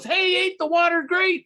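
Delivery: breezy fish showing off the water; cheery fish voice. Acted. A passing fish greets two friends, breezy and high.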